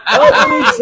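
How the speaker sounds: high, loud whoop of the Italian name; excited echo. Real, not acted. Host cheers owner's name correction, excited echo. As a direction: belt out ah-LEE-chay with delight.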